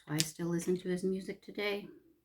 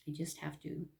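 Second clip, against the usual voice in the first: usual speaking voice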